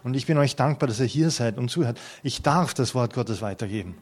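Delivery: ordinary delivery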